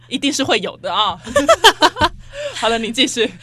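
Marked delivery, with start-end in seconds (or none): 1.2-2.5 higher, louder rising laugh; real laughter. Real, not acted. Cohost laughing about childhood beatings, loud. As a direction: let the laugh ring out.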